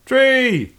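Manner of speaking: high falling cry of "Tree!"; mock cry. Retelling her jump off the cliff, high mock cry. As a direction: cry out high, voice dropping away. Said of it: "on the way down goes".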